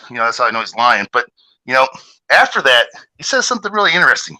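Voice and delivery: ordinary delivery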